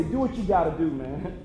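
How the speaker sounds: lightly voiced father, pitched low; a hint of a low-voiced father; imitated father. Father's advice voiced in story.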